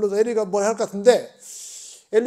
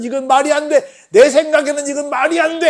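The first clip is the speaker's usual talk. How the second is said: higher, louder offended complaint; indignant grumbling; 7 semitones higher, 7 dB louder than usual. Retold or imitated. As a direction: grumble it like a proud man insulted. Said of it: "투덜거리면서".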